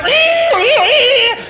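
a loud, high vocal guitar squeal; loud mock electric-guitar whine; imitated guitar wail. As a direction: mimic the lead guitar riff. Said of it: "he's like".

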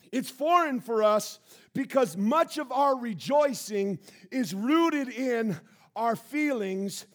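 ordinary talk, nothing marked

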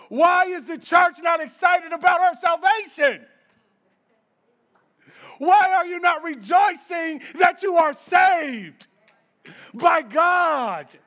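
loud, high challenge to the church; full-force preaching. Acted. Preacher calling out the church, loud full-force preaching. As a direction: preach it hard and call them out.

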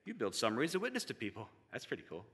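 casual low-key praise between bites of food; Aaron. Retold or imitated. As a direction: imitate an easygoing friend, hushed and offhand. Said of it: "in that happy, monotone voice while eating hot wings".

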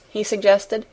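ordinary delivery, nothing marked

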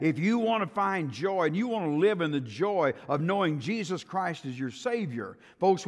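loud, rising pulpit preaching. Acted. Preacher warning the church against defying God, in full preaching voice. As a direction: preach it hard to the congregation.

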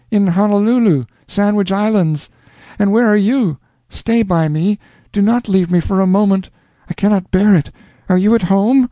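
high, rapturous heroine; cling to him with joy; Rosanna, rapturous. A narrator's reading. Rosanna begging Alonzo to stay, rapturous.

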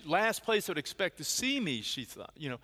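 ordinary talk

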